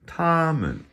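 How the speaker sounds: TOMMEN high on TOM, MEN dropping away; neutral-tone ending. Shown as a demonstration. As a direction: hold TOM high and loud, let MEN fall off toneless. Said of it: "hear the M E N part".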